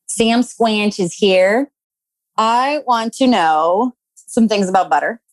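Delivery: usual speaking voice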